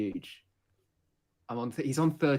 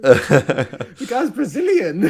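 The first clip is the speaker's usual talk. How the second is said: laughing; 13 semitones higher, 13 dB louder, much slower than usual. Real, not acted. Laughing at the accent joke.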